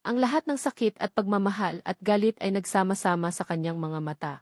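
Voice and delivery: ordinary delivery, nothing marked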